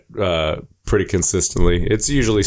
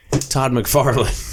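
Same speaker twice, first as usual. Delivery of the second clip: [name] impression; 5 semitones higher, much slower than usual. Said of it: "[name] kinda fucking talks like".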